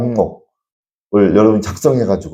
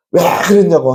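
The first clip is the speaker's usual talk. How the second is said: retold yell; 8 semitones higher, 3 dB louder than usual. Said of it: "막 소리 지르잖아요".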